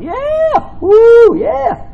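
very high whoops, hammed-up; mock crowd cheering. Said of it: "Everyone's going".

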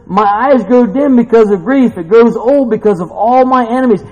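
loud, high-pitched verse quote; fervent preaching. Acted. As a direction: cry the lament out with force.

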